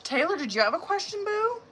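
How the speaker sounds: high, rising question; calling on someone. Real, not acted. Host asking a viewer for their question, high and rising. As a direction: lift it right up at the end.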